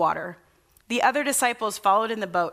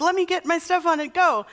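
higher, rushed eager voice; eager Peter; 7 semitones higher than usual. Retold or imitated. Preacher voicing Peter racing to reach Jesus, eager and high. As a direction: hurry like you can't wait to jump in. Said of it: "He's like".

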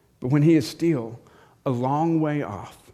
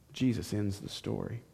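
ordinary delivery

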